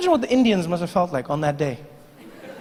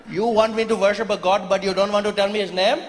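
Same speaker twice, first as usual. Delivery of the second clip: lightly put-on Indian accent; 3 semitones higher than usual. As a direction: argue patiently in an Indian accent. Indian farmer arguing.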